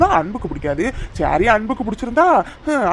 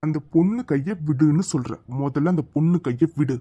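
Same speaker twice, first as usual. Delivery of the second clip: lower, slow commanding hero voice; stern hero; 7 semitones lower, 3 dB quieter than usual. Acted. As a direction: order him slowly like a film hero. Hero confronts the thug. (கதாநாயகன் கடுமையான கட்டளை)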